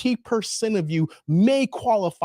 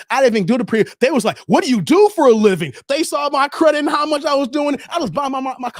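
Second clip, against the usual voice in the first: louder, building excitement at the dealership; excited retelling; 6 semitones higher, 6 dB louder, faster than usual. Real, not acted. Bragging to viewers about the car dealership, loud and excited. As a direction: tell it loud like you can't believe it.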